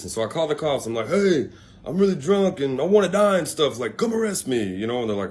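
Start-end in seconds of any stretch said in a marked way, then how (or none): 1.1-4.6 higher-pitched mock drunk plea; retold drunk caller. Drunk man phoning cops to arrest him, mock pleading. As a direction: lightly act out a drunken caller.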